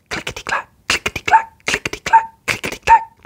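rhythmic train sound; imitated train wheels; clickety-clack. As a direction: chant like wheels on rails.

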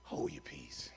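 very low, hushed command; deep, barely voiced order; quiet rebuke. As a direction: rebuke softly, far under your breath. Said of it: "And Jesus rebuked him, saying".

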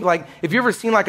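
ordinary talk

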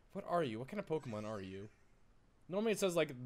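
ordinary talk, nothing marked

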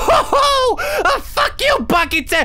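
laughing, shouting in triumph; triumphant yell. Real, not acted. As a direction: yell out the win. Beats granny, triumphant yell.

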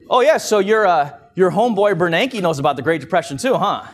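teasing cabbie in a put-on street accent; horrible accent. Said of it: "It's not that I just did a horrible, uh, accent".

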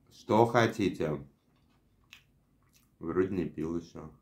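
eating between words; chewing while talking. Real, not acted. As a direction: mouth full of fries. Seen 4: Puts fries in mouth and chews repeatedly while speaking throughout.

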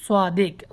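সাদিক said "swaadik", with a heavy rounded s; sad, not sin. Shown as a demonstration. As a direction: thicken the first sound of সাদিক to "swaa". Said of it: "সাদ হবে, সিন হবে না".

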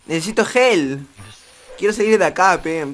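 loud, high cries for rescue; mock-panicked trapped player calling out; playful plea for help. Pide auxilio encerrado en el juego. (gritos de auxilio fingidos)

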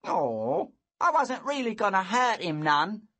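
put-on high loud boy voice; a bully shrugging it off; sassy bully. A narrator's reading.

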